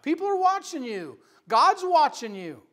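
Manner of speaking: forceful preaching. Real, not acted. Preacher urging people to take life seriously, forceful preaching. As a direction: press it on the congregation with force.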